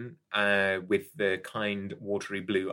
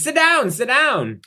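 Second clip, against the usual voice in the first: higher, louder, slow eager welcome; pushy salesman insists she sit; 8 dB louder than usual. Acted. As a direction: pull her into the seat with pushy warmth. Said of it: "And he says".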